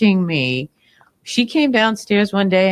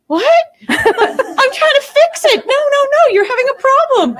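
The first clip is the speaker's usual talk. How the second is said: high, louder bewildered pleading; baffled protest; 14 semitones higher, 5 dB louder than usual. Retold or imitated. Teller confused by her friend, high bewildered voice. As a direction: protest in confusion, wanting to fix it. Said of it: "And I'm going".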